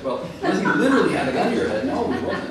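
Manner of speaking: high, loud, laughing correction; laughing pedantic correction. Real, not acted. A speaker mock-correcting people who misuse literally, laughing. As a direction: correct them through a laugh. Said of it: "I have to say yes".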